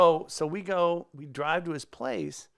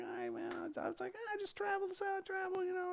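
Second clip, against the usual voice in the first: much higher and softer, a sheepish dodge; evasive reply; 8 dB quieter than usual. Retold or imitated. Softly dodging the host's question about his trip. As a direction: play it off quietly.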